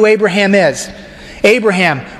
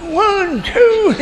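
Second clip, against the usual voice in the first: high, comic counting; Abraham counting; 12 semitones higher than usual. Acted. Abraham counts the stars for God, high and comic. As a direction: count stars in a squeaky old man voice.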